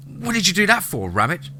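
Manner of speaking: high-pitched, startled complaint; indignant demand. A narrator's reading. Lance just kicked in the shin, indignant demand. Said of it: "Lance demanded".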